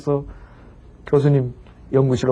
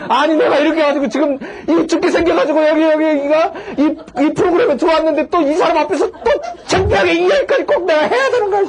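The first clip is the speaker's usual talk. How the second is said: higher, crying-laughing complaint; half-laughing lament; 7 semitones higher than usual. Retold or imitated. Man lamenting to himself, laughing through tears. Retold bitter self-talk.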